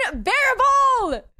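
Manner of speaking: high, loud, drawn-out cry; exasperated outburst. Real, not acted. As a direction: wail at the hecklers in exasperation. Fed up with chat's interruptions.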